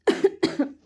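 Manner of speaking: harsh dry cough from a sore throat; a rough cough; real cough. Real, not acted. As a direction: cough hard, clearing a bad throat. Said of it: "मेरा गला आज बहुत ज़्यादा ख़राब".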